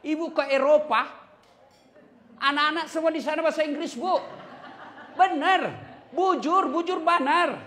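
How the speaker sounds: high, loud gushing brag; excited bragging. Retold or imitated. Mother bragging to the preacher, high excited gushing. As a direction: gush proudly about the kids abroad.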